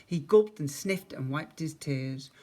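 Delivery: usual speaking voice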